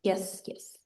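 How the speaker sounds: usual speaking voice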